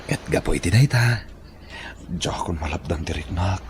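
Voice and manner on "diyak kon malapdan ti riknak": whisper. Acted. Confessing love softly.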